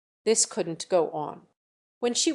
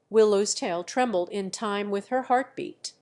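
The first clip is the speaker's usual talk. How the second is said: ordinary delivery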